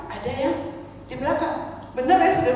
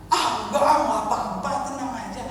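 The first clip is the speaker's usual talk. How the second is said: calm husband; 4 dB quieter than usual. Retold or imitated. Husband to panicking wife about sick child, calm. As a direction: imitate a husband brushing worry away, trailing softer. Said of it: "Suami saya tenang".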